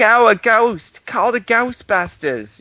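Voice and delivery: high, slow, panicked secretary cry; imitating the Ghostbusters ad secretary, high and slow; mock secretary. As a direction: ham up a shrieking movie secretary. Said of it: "their secretary's like".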